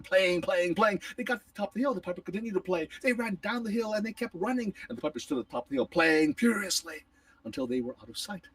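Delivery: ordinary delivery, nothing marked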